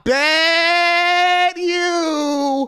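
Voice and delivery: high, loud, drawn-out crowing; exultant mock wail. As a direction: stretch the key words into long high cries. Crowing about tonight's payoff.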